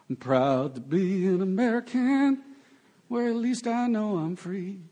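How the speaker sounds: sung in a mock Southern country twang; country accent. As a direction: croon it like a hammy country singer. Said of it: "It's my best country accent ever".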